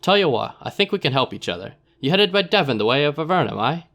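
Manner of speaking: sly dealer in a put-on accent; Narbo's rough accent. Narbo offering a deal in accent. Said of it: "I don't know what accent I'm doing".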